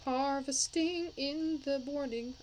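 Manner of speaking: ordinary talk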